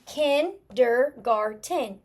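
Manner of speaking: KINDERGARTEN slowly split into four clear syllables. Shown as a demonstration. Teacher rereading a spelling to check it, syllable by syllable. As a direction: sound out KINDERGARTEN one syllable at a time. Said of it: "when I'm stretching through it".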